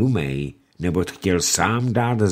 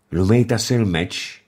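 plain reading voice

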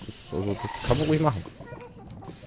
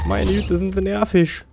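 mock exasperation; 8 semitones higher, 7 dB louder than usual. Mock scolding the mobs.

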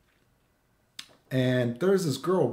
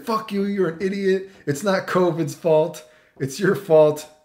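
raised, emphatic imitation of a rant; mock angry; 4 semitones higher, 5 dB louder, slower than usual. Girl commenting on his pictures, mock-angry attack. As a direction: hit each accusation hard, mocking her.

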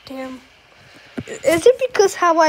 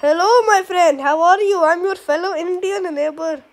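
put-on Indian accent. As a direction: play a friendly neighbour with an Indian accent. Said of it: "I'm gonna act as Indian".

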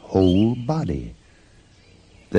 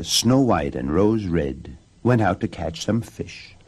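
ordinary delivery, nothing marked

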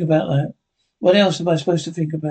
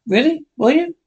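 much higher, questioning lilt; surprised questioning; 10 semitones higher than usual. Acted. Player answering another's claim, surprised and high-pitched. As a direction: pitch jumps up in mild surprise.

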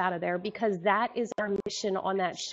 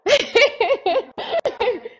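high, loud burst of laughter; high, loud delighted laugh; 12 semitones higher, 9 dB louder than usual. Real, not acted. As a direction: crack up at the joke. Laughing at the intro.

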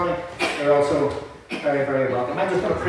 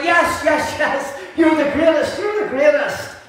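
crowd cheering; 8 semitones higher than usual. Retold or imitated. Preacher voicing the crowd answering Blondin, high and excited. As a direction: throw it back like an eager crowd. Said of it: "And they went".

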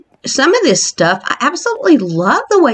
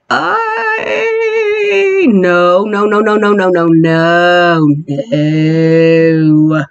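scared; 3 semitones lower, much slower than usual. Real, not acted. Vlogger frightened in the creepy house, rapid scared no's. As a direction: back away repeating no in fright.

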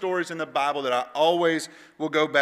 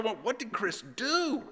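higher, hushed puzzlement; mock-bewildered, pitched up and softer; baffled friends; 7 semitones higher, 5 dB quieter than usual. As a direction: imitate confused kids asking among themselves. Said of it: "all of us are".

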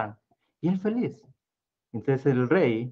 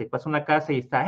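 ordinary delivery

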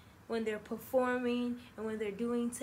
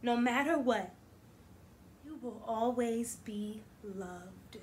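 expressive picture-book read-aloud; reading to children; 11 dB louder than usual. Acted. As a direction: read it warmly to a class. Teacher reads picture book aloud.

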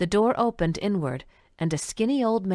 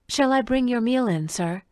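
polite waitress; 6 semitones higher than usual. Acted.

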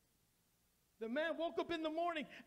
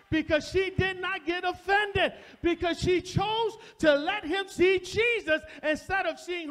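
loud, driving sermon climax; preaching; 4 semitones higher, 11 dB louder than usual. Acted. Preacher telling the church to conquer offense, loud preaching. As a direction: push the message home with full force. Preacher builds to full force.

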